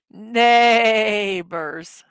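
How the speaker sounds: long drawn-out NEIGH in "Neighbors"; punchline with a hammed-up neigh; horse-neigh pun. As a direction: stretch the first syllable of "Neighbors" like a neighing horse.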